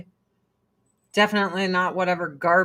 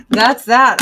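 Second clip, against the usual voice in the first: loud emphatic; 3 semitones higher, 11 dB louder than usual. Real, not acted. Laughing repeat, louder.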